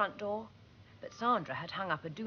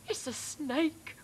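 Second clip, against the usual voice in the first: whimpered fear; 7 semitones higher, slower than usual. A narrator's reading. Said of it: "whimpered one".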